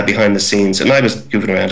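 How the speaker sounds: ordinary delivery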